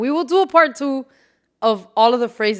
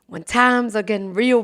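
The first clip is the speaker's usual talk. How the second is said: ordinary talk, nothing marked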